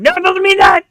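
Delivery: high, loud pleading protest; half-joking high plea to the wife. Retold or imitated. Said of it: "be at my wife saying".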